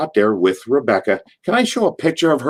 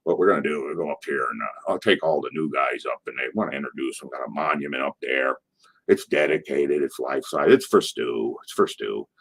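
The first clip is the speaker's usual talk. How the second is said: very low, quiet imitation; little guy putting on a deep voice; Bruce's deep voice; 10 semitones lower, 8 dB quieter than usual. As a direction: rumble it softly, down deep. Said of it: "little guy talks with a deep voice".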